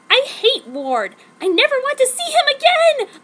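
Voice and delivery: hammed-up wailing cry; crying sadly. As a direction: wail it out in mock anguish. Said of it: "I cried sadly at him".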